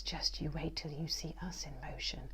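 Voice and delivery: soft, menacing whisper; snail's whisper. A narrator's reading. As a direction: whisper the threat, eyes narrowing. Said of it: "whispers the snail".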